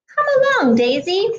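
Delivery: high, loud mother duck call; Mama Duck calling. Acted. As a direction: call out high across the pond to a straying duckling. Mama Duck calls her duckling.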